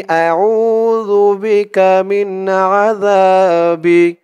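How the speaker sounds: slow, drawn-out recited Arabic; model recitation. Shown as a demonstration. Teacher models the word for viewers to repeat, slow recitation. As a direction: stretch every syllable for them to copy. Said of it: "তো বলুন আমার সাথে".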